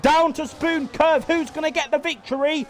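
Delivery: energetic motorsport commentator voice; live race commentary. Acted. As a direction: call the race lap by lap like a broadcaster.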